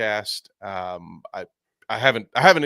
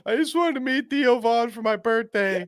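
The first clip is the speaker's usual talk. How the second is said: much higher, put-on little voice; whiny birthday fan; 12 semitones higher than usual. Fan refusing to drop his balloons, high whiny voice. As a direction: whine it like a hurt kid. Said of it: "He's like".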